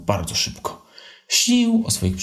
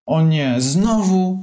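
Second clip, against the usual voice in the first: high, louder cry of annoyance; exasperated; 8 semitones higher, 3 dB louder, much slower than usual. Acted. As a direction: mum fed up with the boys again. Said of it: "zdenerwowała się mama". (mama zdenerwowana, wyżej i głośniej)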